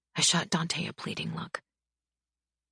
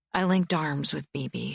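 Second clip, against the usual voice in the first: usual speaking voice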